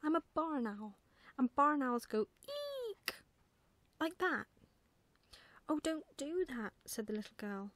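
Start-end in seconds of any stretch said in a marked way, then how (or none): 0.0-4.5 high, loud, indignant owl; cross protest rising into a long high eek; very cross young owl. A narrator's reading. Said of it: "getting very cross". 5.7-6.7 put-on higher-pitched little girl objecting; lifted, mildly upset complaint; pained protest. A narrator's reading.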